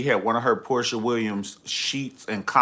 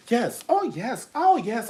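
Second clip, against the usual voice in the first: mock diva; 11 semitones higher than usual.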